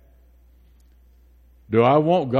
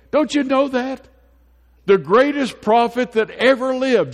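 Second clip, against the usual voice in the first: pleading John the Baptist; 8 semitones higher than usual. Retold or imitated. John the Baptist pleading with his disciples, high and earnest. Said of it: "He says".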